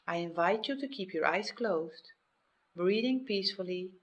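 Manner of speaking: ordinary talk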